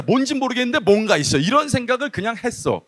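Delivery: ordinary talk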